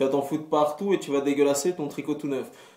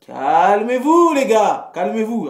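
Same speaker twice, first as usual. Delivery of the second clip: mock calming shout; 7 semitones higher, 9 dB louder, slower than usual. Calming excited fans.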